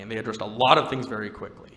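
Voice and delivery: ordinary talk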